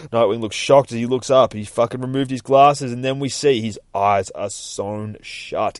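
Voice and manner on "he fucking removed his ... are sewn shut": ordinary delivery, nothing marked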